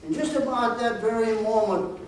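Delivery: ordinary delivery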